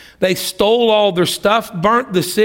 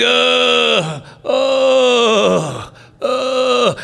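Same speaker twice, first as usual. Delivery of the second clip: high, wailing mock moans of a sick man; mock moaning; 6 semitones higher, much slower than usual. Preacher mimics the dying servant moaning in the bush, wailing. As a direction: groan it out like a man left to die.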